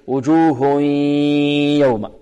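وجوه يوم with a long nasal ya; merging with ghunnah. Shown as a demonstration. Quran teacher explains sound from the nose, merging with ghunnah. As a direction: hold the hum in the nose between وجوه and يوم. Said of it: "مصاحبة لغنة ماذا؟ من الخيشوم".

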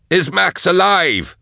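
high, drawn-out yell; urgent high-pitched shout. A narrator's reading. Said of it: "Drusha yelled".

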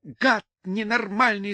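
high-pitched, slow insult; angry yell. A narrator's reading. Людмила yells at him as she runs, angry. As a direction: cry out the insult, drawn out. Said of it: "закричала Людмила".

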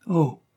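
high, breathy "Oh" of despair; despairing gasp. A narrator's reading. Barbara, stricken, gasps at her husband's answer. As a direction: let the shock escape as a breath. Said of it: "s'écria la jeune femme avec désespoir".

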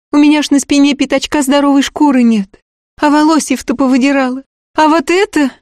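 put-on high, eager young servant girl; peasant girl voice. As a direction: pitch it up as a simple village girl. Girl tells of beatings.

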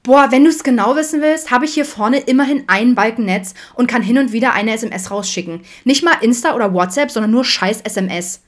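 high, loud indignant outburst; exasperated teen rant. A narrator's reading. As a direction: rant at Dad, fed up. Teen rants back at her father.

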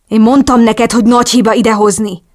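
loud, angry reproach; irritated shout. A narrator's reading. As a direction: narrator acting an exasperated man yelling. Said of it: "ordította Tony ingerülten".

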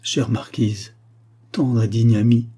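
plain reading voice

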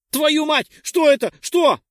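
high, loud panicked yelp; startled cry. Acted. Dex yelps at the creature on his shoulder, frightened cry. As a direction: yell it out in sudden fright. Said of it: "Напарник вскрикнул".

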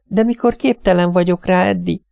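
high, plaintive wail; wailing wife, pitched high and pleading. A narrator's reading. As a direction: whimper it like a helpless wife. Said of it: "siránkozott az asszony".

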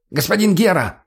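high, urgent call to a superior; lightly acted breathless soldier; breathless messenger. A narrator's reading. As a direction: burst in with urgent news. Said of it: "ворвался запыхавшийся боец".

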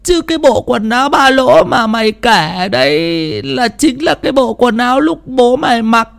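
high, slow and eerie, as if from beyond; a wailing spirit calling its child, drawn out; eerie ghostly voice. Acted. (giọng hồn ma cao, chậm, rờn rợn)

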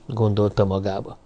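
usual speaking voice

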